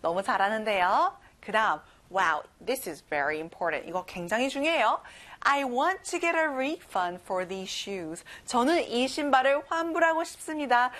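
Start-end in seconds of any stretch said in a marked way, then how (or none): 5.4-8.2 very slow, carefully spaced sentence; slow model sentence. Shown as a demonstration. Teacher modelling a refund request slowly. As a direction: say it slowly to be memorised. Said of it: "이거 달달달 외우세요".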